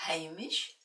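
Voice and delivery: ordinary delivery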